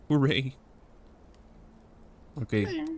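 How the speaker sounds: ordinary delivery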